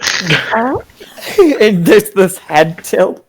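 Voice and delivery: ordinary talk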